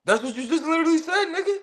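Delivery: high laughing protest. Real, not acted. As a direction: laugh it out in disbelief. Laughing through a retort.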